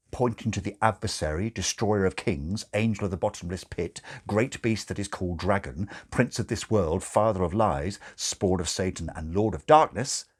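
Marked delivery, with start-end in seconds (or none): none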